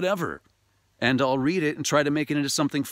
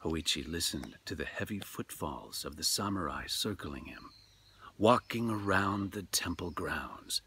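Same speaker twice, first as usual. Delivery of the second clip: measured, hushed storyteller read; story narration; 7 semitones lower, 10 dB quieter than usual. A narrator's reading. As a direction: tell it like a ghost tale by lamplight.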